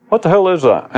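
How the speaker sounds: high, puzzled demand; inner voice, questioning. Acted. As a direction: ask it high, as the voice in someone's head. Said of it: "It's the voice in you wanting to know".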